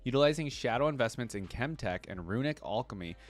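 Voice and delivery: ordinary delivery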